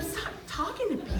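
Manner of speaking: high, hushed telling-off; hushed scolding. Retold or imitated. Friend shushing her during the show, hushed scolding. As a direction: shush them in a small, high voice.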